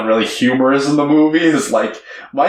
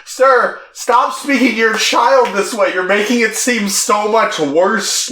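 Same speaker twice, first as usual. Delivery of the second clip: high-pitched exasperated protest; mock reproach; 9 semitones higher than usual. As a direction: indignantly tell off a bad parent, playfully. Imagined scolding of the dad.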